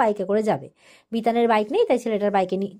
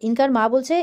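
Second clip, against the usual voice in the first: normal talking voice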